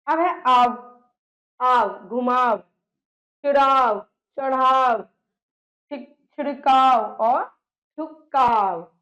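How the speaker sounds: teacher stressing the suffix आव; emphatic word list. Shown as a demonstration. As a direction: recite each word loudly. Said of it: "आव की ध्वनि".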